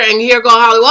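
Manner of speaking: ordinary talk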